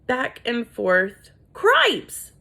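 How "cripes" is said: high, loud startled squeak; a shocked little yelp; startled cry. Acted. Furlow startled at what he sees. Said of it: "said Furlow".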